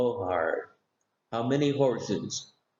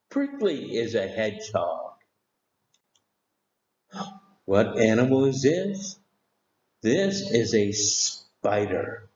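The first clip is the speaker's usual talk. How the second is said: normal talking voice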